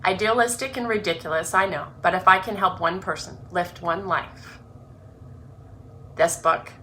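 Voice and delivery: earnest, measured reading of her own introduction; reading her book aloud. Acted. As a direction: read it like a heartfelt opening chapter.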